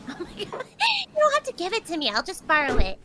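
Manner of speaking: high, loud flustered protest; excited protest. Real, not acted. Protesting generous gift.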